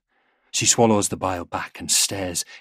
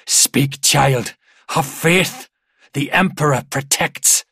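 loud, raised, forceful exhortation; urgent command; 6 semitones higher, 7 dB louder, slower than usual. A narrator's reading. Father urges his gasping daughter to speak, loud and commanding. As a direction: urge the child on with fervour.

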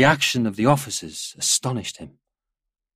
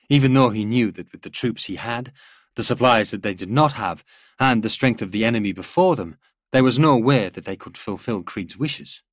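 usual speaking voice

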